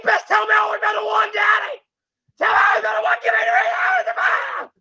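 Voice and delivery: high demand building into a shriek; pleading to screaming. Acted. Demanding Daddy say she's the one, building to screaming. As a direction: let the begging climb into a frantic squeal.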